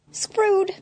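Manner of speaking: high dismayed yelp. Acted. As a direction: wince it out, voice up high. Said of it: "Eddie grimaced".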